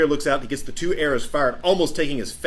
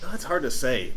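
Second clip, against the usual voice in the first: ordinary talk, nothing marked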